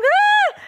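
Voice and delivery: loud, high loon wail; loon cry. Retold or imitated. After the eagle leaves, a loud high loon cry. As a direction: let the loon cry ring out. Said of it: "the loons are still going".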